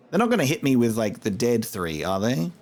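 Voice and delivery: usual speaking voice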